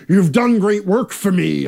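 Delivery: loud, hearty battle-axe lord; lord in his hall. Shown as a demonstration. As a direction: proclaim it as a warlord greeting returning heroes. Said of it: "the lord in his hall".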